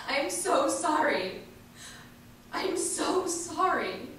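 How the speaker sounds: tearful apology. Retold or imitated. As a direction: relive the sobbing apology to the tree, slowly. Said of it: "was crying and apologizing over and over".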